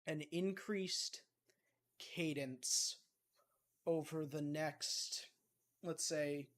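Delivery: normal talking voice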